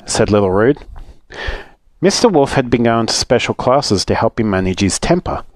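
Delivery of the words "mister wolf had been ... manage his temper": usual speaking voice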